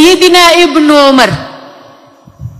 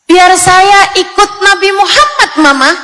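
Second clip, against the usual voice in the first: put-on high-pitched eager child; child answering Mama; 5 semitones higher than usual. Acted child answering mother. (suara anak kecil yang tinggi)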